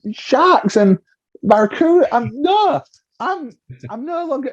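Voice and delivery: very high, gushing with delight; giddy excitement. Retold or imitated. Telling the crew about sharks, high giddy gushing. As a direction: rave about the sea life. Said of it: "And I was like".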